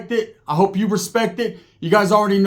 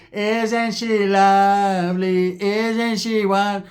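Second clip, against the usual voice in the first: slow, syrupy fake-sweet gush; syrupy sweet tias; much slower than usual. Two feuding sisters gushing over each other, fake-sweet and slow. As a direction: coo it slowly with fake sweetness.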